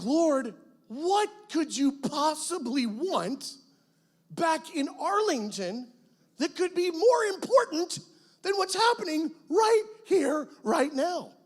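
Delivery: high, slow, incredulous questioning; indignant plea. Retold or imitated. Young missionary protesting to God about going home, high, slow and indignant. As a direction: challenge God in disbelief.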